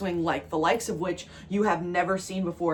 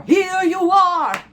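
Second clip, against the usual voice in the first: high, loud, slow exclamation; big excited declaration; joyful shout; 9 semitones higher, 7 dB louder, much slower than usual. Real, not acted. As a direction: proclaim it with delight.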